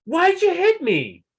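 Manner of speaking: very high, loud, slow whine; a giant's wounded complaint; first giant blaming his brother in a squeal; high squeaky giant. Acted. Giant accuses the other.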